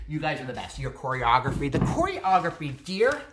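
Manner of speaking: ordinary delivery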